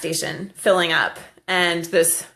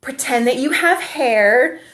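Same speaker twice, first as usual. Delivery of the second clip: put-on little girl; 6 semitones higher, 3 dB louder, slower than usual. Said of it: "she looks at me and she's like".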